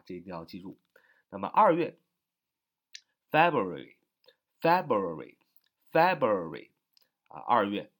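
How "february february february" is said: February drilled slowly, louder, Feb stressed; slow, loud teacher drill of February, stress up front; stress on the first syllable. Shown as a demonstration. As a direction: drag out February three times, leaning on Feb. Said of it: "重音也是在最前面".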